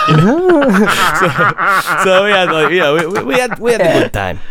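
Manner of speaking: high-pitched laughing talk. Real, not acted. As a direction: laugh through the words. Laughing after his own joke.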